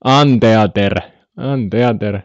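Anteater as an-te-a-ter, emphatic; Finnish spelling pronunciation. Shown as a demonstration. Streamer insisting on the joke pronunciation, Anteater with emphatic Finnish vowels. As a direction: sound out every vowel of Anteater, Finnish style. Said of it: "Ei kun anteater".

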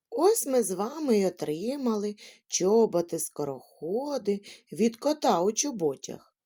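ordinary delivery

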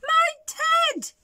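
very high shocked exclamation; big bear's astonished squeal; gasped. A narrator's reading. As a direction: gasp it out high in alarm. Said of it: "gasped the bear".

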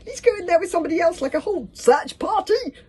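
high, loud, fast squeal; frantic pleading. A narrator's reading. Fishlegs begging Hiccup for a search party, frantic pleading. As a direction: gabble it in a squeaky panic.

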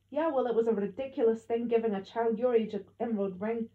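loud, scornful retort; angry scorn. A narrator's reading. Gran scorning Dad's gift to child, angry. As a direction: snap back loudly in disapproval.

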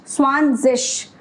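स्वानजिश slowly, final ig said as इश; ending sounded "ish". Shown as a demonstration. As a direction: draw out स्वानजिश and land on a clear sh. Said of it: "ये इश की sound निकालता है". (स्वानजिश, आख़िर में इश की आवाज़)

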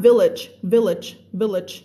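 village village village, slow and clear; pronunciation drill. Shown as a demonstration. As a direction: model the word village slowly for students. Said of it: "ออกเสียงนะคะ".

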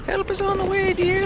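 very high sweet voice; gif quote voice. Retold or imitated. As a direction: squeak it out sweetly. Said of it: "my favorite gif ever".

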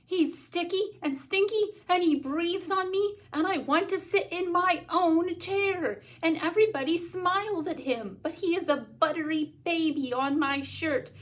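put-on high, loud, wailing child's rant; yelled tirade. A narrator's reading. Child listing grievances about little brother, upset yelling. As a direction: pour out every grievance at a high pitch. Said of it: "I yell".

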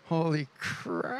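slow, hushed and drawn out; a quiet, slow sinking realisation; stunned dread. Retold or imitated. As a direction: draw it out softly in mock dread. Retelling his panic at seeing leaders.

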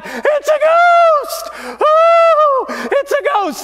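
high, loud panicked scream of frightened fishermen; terrified cry. Acted. Fishermen in the boat screaming at a ghost, panicked high shriek. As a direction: shriek it like you've seen a ghost. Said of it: "they started crying out".

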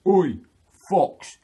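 loud, high challenge through a door; calling out. Acted. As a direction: shout like a bear summoning a fox. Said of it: "he knocks on the door and he said".

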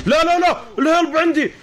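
high panicked yell about a grenade; grenade warning yell. Real, not acted. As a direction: shout the grenade warning in alarm. Teammate being grenaded in game.

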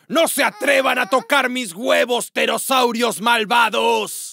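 furious roaring shout; unhinged villain. Acted. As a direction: bellow it like a raging monster. T-rex guarding eggs, shouting. (grito furioso de villano desquiciado)

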